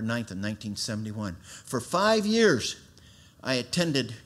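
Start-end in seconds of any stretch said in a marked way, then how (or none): 1.4-2.8 slow, higher and louder, each word weighted; slow, emphatic. Real, not acted. Preacher stressing the time to the church. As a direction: drag out the years for weight.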